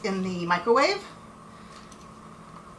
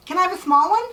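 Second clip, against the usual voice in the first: high, louder begging; pleading; 10 semitones higher, 4 dB louder, faster than usual. Real, not acted. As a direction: plead for just a small one. Begging wife for a taste.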